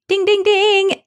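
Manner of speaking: high, loud bell-like chime; game show bell. Pretend. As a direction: ring it out like a quiz show. Game show correct answer.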